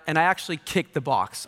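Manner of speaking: ordinary talk, nothing marked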